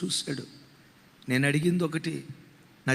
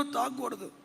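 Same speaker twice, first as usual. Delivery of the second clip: fading repetition; 3 semitones higher, 7 dB quieter than usual. Retold or imitated. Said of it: "ఏడుస్తూ అంటాడట".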